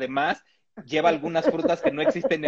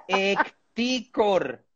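ECTICOR very slow, each syllable stretched; incredulous, slow-motion Ecticor; drawn-out repeat; 4 semitones higher, much slower than usual. Shown as a demonstration. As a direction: say Ecticor slowly, savouring every syllable. Repeats the nickname slowly for effect.

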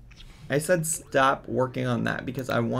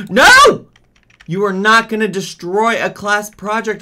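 loud, high gasp then alarmed shout; real alarmed outcry; shocked gasp and cry; 7 semitones higher, 13 dB louder than usual. Real, not acted. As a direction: react in horror. Sim destroying project.

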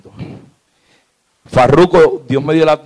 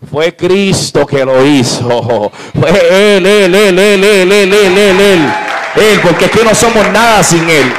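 loud preaching shout, drawn out; prédica a pleno pulmón; 4 semitones higher, 6 dB louder, slower than usual. Real, not acted. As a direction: shout it rhythmically, hammering each "Él". (grito de predicador, fuerte y sostenido)

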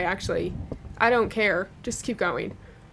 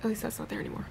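whispered, much softer; 14 dB quieter, much faster than usual. Real, not acted. Player whispering relief while exploring. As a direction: breathe it out in relief.

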